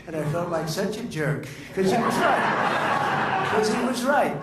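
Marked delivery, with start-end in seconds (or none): 1.7-3.5 laughing it out, high and breathy; real laugh through the words. Real, not acted. Laughing at himself, caddy was right. 3.5-4.4 higher and louder, pressing the point home; loud, emphatic insistence; emphatic repeat. Real, not acted.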